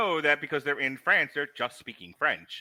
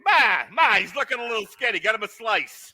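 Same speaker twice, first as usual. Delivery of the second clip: mock Brooklyn mama; 8 semitones higher, 7 dB louder than usual. Acting a Brooklyn mother.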